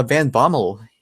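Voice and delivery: plain reading voice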